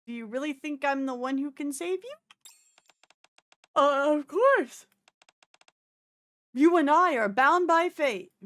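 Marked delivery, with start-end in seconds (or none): 3.8-8.3 loud, slow, earnest proclamation; loudly devoted, stretched out; fervent declaration. Acted. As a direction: announce it like a vow. Label earnestly declares fate.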